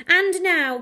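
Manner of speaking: loud, high showman's "And now"; Coco announcing. Acted. Conjurer Coco calls the dogs to the dance floor, announcing. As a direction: call the room to attention like a conjurer. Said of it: "Coco said".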